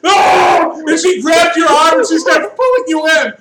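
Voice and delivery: high, loud frantic shout; panicked yelling. Acted. GM yelling at One Arm to stop, frantic shout. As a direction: scream at the beast to let go.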